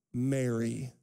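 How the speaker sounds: Jesus gently calling Mary's name; tender call. Acted.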